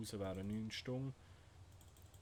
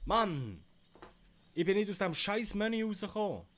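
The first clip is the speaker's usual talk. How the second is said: loud, higher furious rant; angry ranting; 9 semitones higher, 10 dB louder than usual. Real, not acted. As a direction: rant at the lost progress. Lost unsaved progress.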